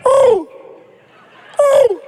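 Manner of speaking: high, loud hooting bird call. Retold or imitated. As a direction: hoot like a bird across the aisle. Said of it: "making my own bird call back".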